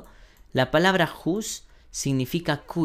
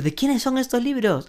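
high, questioning student voice; asking voice; 10 semitones higher than usual. Pretend. Teacher acting a student asking whose books. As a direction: lightly play the one asking.

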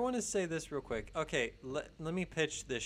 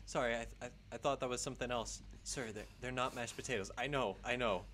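fast, lower, flustered excuse; flustered apology; 3 semitones lower, faster than usual. Retold or imitated. Astronaut caught nibbling a plant, fast flustered apology. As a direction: rush through it, caught out.

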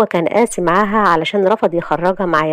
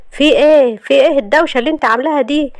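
high, raised-voice irritation; exasperated; 7 semitones higher, 4 dB louder than usual. Acted. Husband woken by wife's uproar, exasperated. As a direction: push back against the noise, angrily. Husband snaps back in quarrel.

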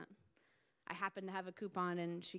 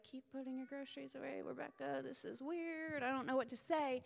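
inner voice; 6 semitones higher than usual. Retold or imitated. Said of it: "at first I was just like".

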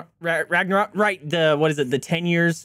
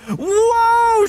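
high, loud startled cry; startled whoa; 6 dB louder than usual. Real, not acted. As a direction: yelp as the sled swerves. Sled sharp turn.

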